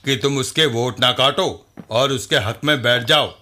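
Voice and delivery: low-pitched heavy for a political party; deep, level voice delivering a demand; party henchman making a demand. Acted. Party man telling rival candidate to withdraw, low-pitched demand.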